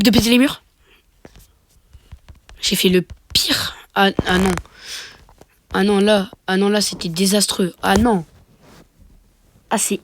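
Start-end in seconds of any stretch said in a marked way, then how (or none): none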